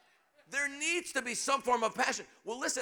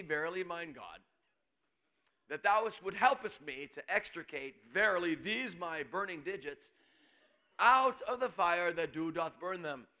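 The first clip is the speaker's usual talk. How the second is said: mock biblical prophet; 4 semitones lower than usual. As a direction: pray in mock Old English. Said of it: "says this kind of a prayer".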